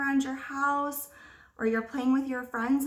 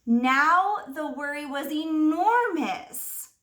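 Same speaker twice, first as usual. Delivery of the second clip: dramatic storybook swell; 3 semitones higher, 6 dB louder, slower than usual. Acted.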